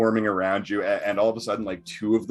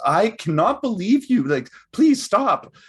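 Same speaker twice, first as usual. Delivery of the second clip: high, loud scolding mother; loud, much higher-pitched parental exasperation; exasperated mama bear; 8 dB louder than usual. Acted. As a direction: scold the cubs like a fed-up mum. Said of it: "that mama bear comes over and says".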